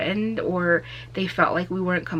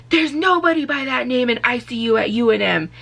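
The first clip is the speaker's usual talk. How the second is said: curt officer; 5 semitones higher, 5 dB louder than usual. Retold or imitated. Officer dismissing her.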